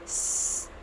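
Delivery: स as a soft unvoiced hiss; whispered hiss. Shown as a demonstration. Teacher modelling S as a quiet hiss. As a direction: breathe स out quietly. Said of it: "स नहीं बोलेंगे".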